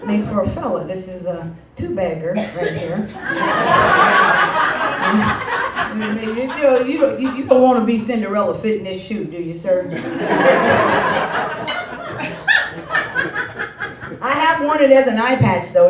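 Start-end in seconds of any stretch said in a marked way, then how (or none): 3.1-5.9 airy unvoiced laugh at own joke; breathy laugh. Real, not acted. Comic to the crowd after a crude joke, breathy laugh. 9.9-14.2 airy unvoiced laugh at own joke; breathy laugh. Real, not acted. Comic to the crowd after a crude joke, breathy laugh.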